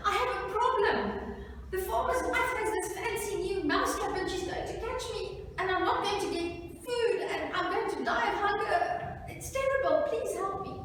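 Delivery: high-pitched, urgent little mouse; pleading mouse. Acted. Mouse begs the pig for help, high and desperate. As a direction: beg desperately in a high put-on voice.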